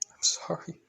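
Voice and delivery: acted tearful whisper; crying. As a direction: whisper a broken apology as if crying. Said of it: "With him crying".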